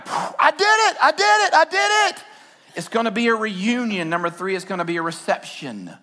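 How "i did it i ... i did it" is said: high, repeated cry of triumph; jubilant. Pretend. A believer arriving in heaven, high jubilant chanting. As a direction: chant it like you crossed the finish.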